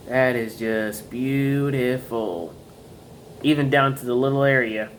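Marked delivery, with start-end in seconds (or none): 0.1-2.5 lingering, low-pitched appreciation; slow admiration. Real, not acted. Collector showing viewers the engraving, slowly admiring. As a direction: savour each word, slow and low.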